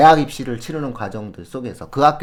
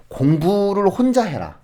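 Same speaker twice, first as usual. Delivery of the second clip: mock nagging parent; 8 semitones higher, 5 dB louder, slower than usual. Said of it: "이렇게 생각하지 마세요".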